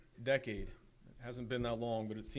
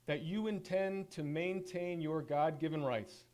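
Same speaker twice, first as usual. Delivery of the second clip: ordinary talk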